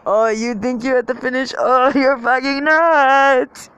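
high, loud taunting inner bully; hammed-up yelling inner critic; self-destructive inner voice. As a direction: build to a long, high mock yell. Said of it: "the self-destructive part of me is like".

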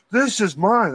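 high-pitched imitation of a defiant thief; mock defiant claim. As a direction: stake the claim, pitch leaping up. Retold thief defiantly claims the item.

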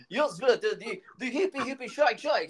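put-on high, giggly Russian accent; mock Russian accent. Quoting the TV sketch for laughs, high mock Russian accent. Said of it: "old mate from Fast Forward, the T V show".

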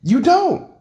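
high, gloating put-down; taunting. Real, not acted. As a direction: rub in the win. Triumphant taunting in argument.

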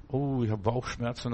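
soft, low moaning patient voice; complaining patient. Retold or imitated. Patient complains of stomach ache to the doctor, moaning softly. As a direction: play the sorry patient groaning about his stomach.

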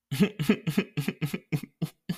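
high staccato laugh. Real, not acted. Speaker after playing a cursing God, amused laughter. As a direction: burst into a run of high chuckles.

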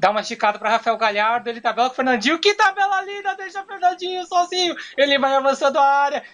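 rising play-by-play into goal cry; radio goal commentary. Acted. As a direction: narrate like a Brazilian radio man. [name] narrates Grêmio goal.

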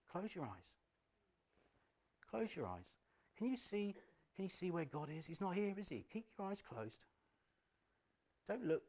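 high, soft, coaxing whisper-like voice; right in your ear. Acted. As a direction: seductive tempter close in the ear. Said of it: "He will be this really seemingly soothing voice".

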